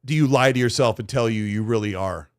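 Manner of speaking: ordinary delivery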